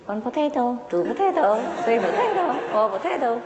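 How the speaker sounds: high, parrot-like "potato" chant; sing-song it like a talking parrot; squawky parrot voice. Retold or imitated. Hidden parrot chanting to the plumber, high squawk. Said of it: "he heard a voice say".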